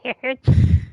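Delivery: a loud, open laugh at himself; bright laugh at his own chipmunk voice. Real, not acted. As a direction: burst of real laughter.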